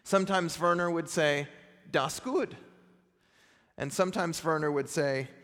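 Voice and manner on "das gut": put-on German accent. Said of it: "That's my attempt at a German accent".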